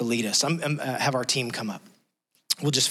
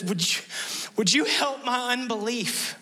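higher, begging with hesitation; pleading father; 9 semitones higher than usual. Retold or imitated. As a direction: ask it high, unsure and pleading.

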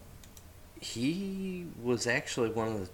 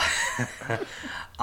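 laughing; 3 semitones higher than usual. Real, not acted. Laughs after saying she's high.

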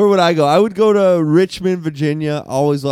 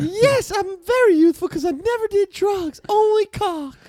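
much higher scolding wife voice, hammed up; shrill-free but far higher mock first lady; Nancy Reagan; 12 semitones higher than usual. Wife scolds Ronald over drugs, much higher mock Nancy voice. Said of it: "She sounds so youthful".

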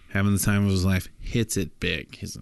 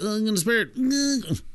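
much higher, a squealing peak of pleasure; high climax cry; 14 semitones higher than usual. Acted. John to the prostitute, a high squeal of pleasure. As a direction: let it fly up high in ecstasy.

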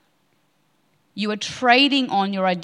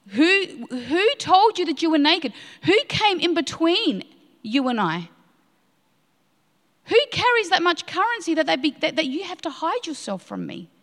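higher, aching plea of a hurt God; the Lord pleading; 6 semitones higher than usual. Acted. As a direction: ask it as a wounded father calling out.

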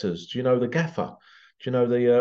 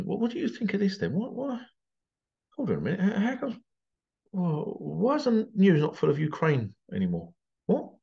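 pitched-up mock-innocent questions; feigned puzzlement, higher voice; sarcastic; 7 semitones higher than usual. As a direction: play dumb, dripping with sarcasm. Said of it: "Yes, it's sarcasm".

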